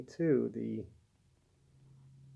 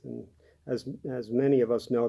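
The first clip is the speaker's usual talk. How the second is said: normal talking voice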